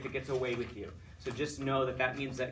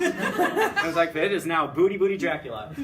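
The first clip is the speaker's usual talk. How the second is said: loud, high, laughing announcement; 3 semitones higher, 9 dB louder than usual. Real, not acted. Teacher retelling his favorite example, loud and laughing. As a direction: proclaim it through laughter.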